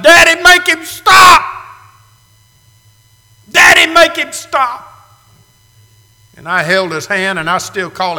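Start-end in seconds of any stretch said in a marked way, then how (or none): 0.0-4.9 put-on high, loud desperate child's plea; desperate cry. Preacher retelling his injured son crying out, loud and desperate. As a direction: scream for your father to make it stop. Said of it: "Clay reached up and cried out".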